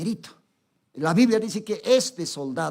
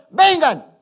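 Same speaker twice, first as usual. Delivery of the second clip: centurion barking an order, raised and loud; commanding shout; 7 semitones higher, 8 dB louder than usual. Retold or imitated. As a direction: call the soldiers in like a commander. Centurion orders his soldiers. (grito de mando)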